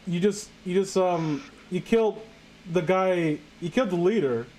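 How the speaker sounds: ordinary delivery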